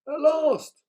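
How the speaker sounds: high, loud troll cry of joy; excited cry. A narrator's reading. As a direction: shout it out as the delighted troll. Troll voice in read story.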